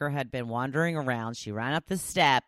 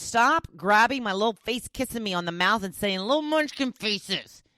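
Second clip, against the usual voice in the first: high, louder fussy pet voice; reading a pet's complaint in a high put-on voice; annoyed pet voice; 9 semitones higher, 5 dB louder than usual. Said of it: "Nicole says".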